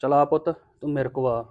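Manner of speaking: gentle soothing. Acted. Man taking the crying baby to himself, gentle. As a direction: drop the anger and soothe softly.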